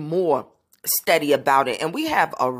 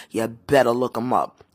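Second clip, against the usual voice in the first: ordinary talk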